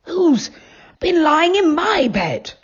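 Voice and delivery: mild growly bear voice; a lightly growled bear question; growly father bear. A narrator's reading. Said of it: "in a growly voice".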